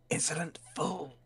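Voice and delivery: high, haughty put-on villain voice; Loki voice. Retrying the Loki voice for a video, haughty and high. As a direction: sneer it down at a mortal. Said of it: "I came back to it and went".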